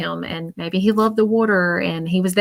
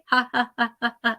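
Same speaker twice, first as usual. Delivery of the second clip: soft imitated spirit laugh; a light, quiet ha ha ha; mock laugh; 3 semitones higher, 5 dB quieter than usual. Spirit laughs at his joke.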